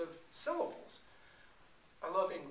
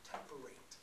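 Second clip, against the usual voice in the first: ordinary talk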